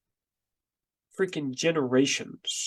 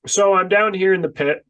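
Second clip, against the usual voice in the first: ordinary talk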